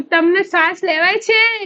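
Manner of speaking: imitating her own panicked shout; mock yell. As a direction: call the question out in a half-playful yell. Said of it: "હું બૂમો પાડું".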